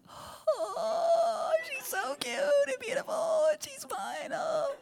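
very high squealing, tearful coo; doting parent. Retold or imitated. Father gushing over his little girl, high crying coo. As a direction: squeal over your own cute child.

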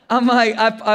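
usual speaking voice

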